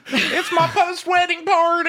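high, slow, laughing squeal of a bride; mocking Brittany. Friends mock Brittany's endless wedding parties, squealing high. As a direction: shriek it like a giddy bride. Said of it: "Is Brittany still having parties".

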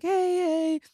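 high, drawn-out awkward hello; awkward greeting. Retold or imitated. Room full of podcasters greeting the actor, high and awkward. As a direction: mimic a tentative group greeting.

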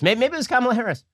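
high-pitched sarcastic amazement; mock wonder. Host pretending the little girl was Harris, mock wonder. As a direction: float it up as if naively marvelling.

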